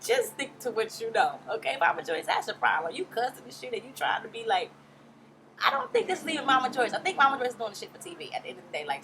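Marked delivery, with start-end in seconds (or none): none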